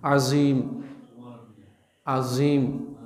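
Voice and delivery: عظیم said azim, with a plain z instead of ظ; عظیم voiced as azim, the wrong way; wrong z sound. Shown as a demonstration. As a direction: say عظیم with a clear z, the mistake. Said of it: "یہ زا پڑھ رہے ہیں".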